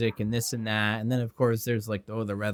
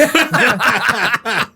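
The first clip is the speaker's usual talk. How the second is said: laughing; 11 semitones higher, 10 dB louder than usual. Real, not acted. Joke about Mountain Mama song.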